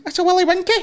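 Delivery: put-on high kid in Scottish accent; a wee Scottish boy voice. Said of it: "I think it's Scottish".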